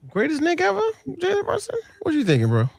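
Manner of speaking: usual speaking voice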